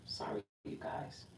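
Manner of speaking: quick whispered sorry aside; whispered apology. Real, not acted. Reader apologises to viewers under her breath, whispering.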